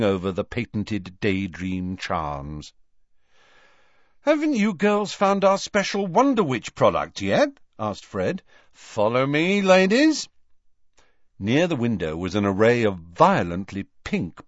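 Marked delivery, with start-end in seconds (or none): 4.2-10.3 high, showy sales invitation; twin salesman Fred, inviting. A narrator's reading. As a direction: usher customers along with a flourish.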